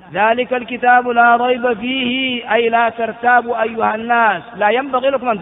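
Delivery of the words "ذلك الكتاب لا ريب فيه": slow, drawn-out recited verse; measured tajwid reading; Quranic recitation. Shown as a demonstration. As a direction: chant the verse slowly and evenly.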